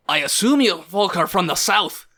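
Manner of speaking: put-on menacing warrior voice; role-played threat with a rough edge; threatening axe man. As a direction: warn them off as a hostile gang leader.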